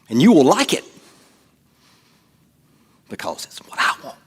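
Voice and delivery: insist, then hiss the last part; selfish demand. Retold or imitated. Preacher mocking a selfish person's demand.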